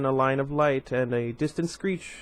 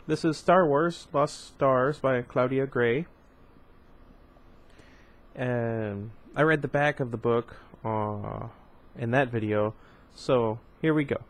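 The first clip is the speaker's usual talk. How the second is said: plain reading voice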